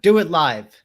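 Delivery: loud, high "do it live" callout; hyped shout. Acted. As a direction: bellow it like a hype man.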